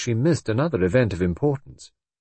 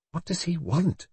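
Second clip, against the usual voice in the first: high, slightly hushed nervous question; 9 semitones higher, 4 dB quieter than usual. A narrator's reading. Brenda watching the inspector approach, nervous. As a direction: ask it uneasily, pitched up high. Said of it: "she repeated nervously".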